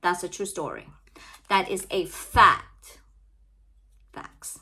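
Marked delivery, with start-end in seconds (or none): 4.1-4.6 a breathy whispered aside. Real, not acted. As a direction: whisper it to yourself like a private joke. Whispered aside after affirming it.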